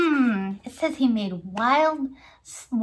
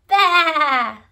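loud sheep baa; sheep bleat; 3 semitones higher, 8 dB louder than usual. Shown as a demonstration. Teacher ends the sheep song, bleating. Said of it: "What sound does a sheep make".

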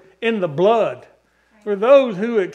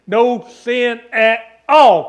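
slow emphatic proclamation; 4 semitones higher, 8 dB louder, much slower than usual. Real, not acted. Climax of a preached list.

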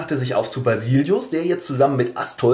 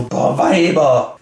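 exasperated sigh; much slower than usual. Acted. Exasperated man after women leave.

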